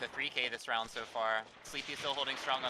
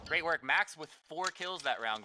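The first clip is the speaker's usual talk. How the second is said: esports caster; 4 semitones higher, slower than usual. Real, not acted. Caster hyping a clutch round.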